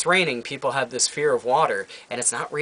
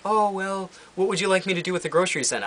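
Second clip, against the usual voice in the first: higher, fast clerk voice; mock cashier voice; 7 semitones higher than usual. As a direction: rattle it off like the cashier. Store cashier quoted by customer.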